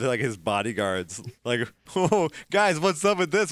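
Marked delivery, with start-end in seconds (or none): 2.0-3.5 high, friendly mock greeting; chummy. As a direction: hail the guys like old pals. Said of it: "really chummy".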